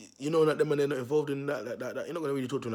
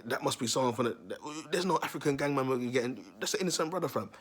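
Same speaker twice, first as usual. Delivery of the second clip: ordinary talk, nothing marked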